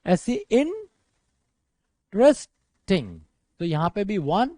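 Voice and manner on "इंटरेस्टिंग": इंटरेस्टिंग in three slow, separate chunks; long pauses between the parts of इंटरेस्टिंग; split in three parts. Shown as a demonstration. Teacher showing a long adjective's syllables, इंटरेस्टिंग broken in three. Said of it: "तीन part में आपने इस word को बोला".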